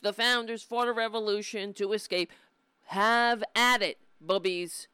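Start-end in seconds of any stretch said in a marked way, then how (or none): none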